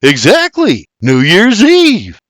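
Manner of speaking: slow, emphatic New Year's Eve!; exclaiming. Acted. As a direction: drag out Eve to prove the point. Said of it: "with a raised brow exclaims".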